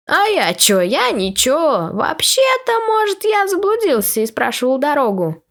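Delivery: high, defensive innocent excuse; innocent dog voice. Acted.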